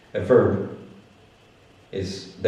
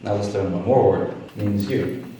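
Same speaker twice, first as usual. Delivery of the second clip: ordinary talk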